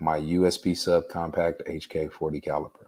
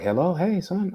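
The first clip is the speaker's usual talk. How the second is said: higher-pitched imitation of mom picking up; mother's voice; 12 semitones higher than usual. Son retelling his mother answering the phone, higher imitated voice.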